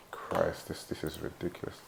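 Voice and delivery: exasperated whisper under the breath; whispered annoyed mutter. Real, not acted. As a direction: mutter it to yourself, fed up. Annoyed by notifications mid-lesson.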